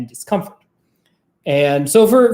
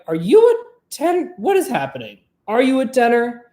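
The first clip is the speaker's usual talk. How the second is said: baffled listener; 7 semitones higher than usual. Retold or imitated.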